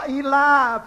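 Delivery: ordinary delivery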